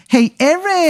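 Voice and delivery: very high falsetto hailing call; hammed-up, drawn-out high character voice; Chet Lightning. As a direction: sing out a greeting in a silly squeal. Game master voices Chet Lightning.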